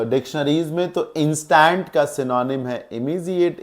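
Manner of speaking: ordinary talk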